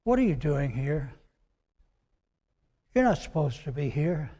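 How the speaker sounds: a soft still voice asking; still, small voice. Acted. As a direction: speak it like a quiet inner voice. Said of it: "in a still, small voice".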